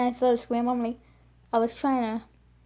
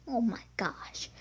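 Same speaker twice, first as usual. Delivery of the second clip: slow, softer whispered disbelief; 2 semitones lower, 8 dB quieter than usual. Retold or imitated. Kid reacting to mom's mistake, slow whisper. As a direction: breathe it under your breath. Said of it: "I'm like".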